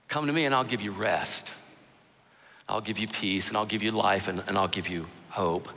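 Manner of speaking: a touch of Jesus comforting; tender promise. Retold or imitated. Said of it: "Jesus offers you the invitation".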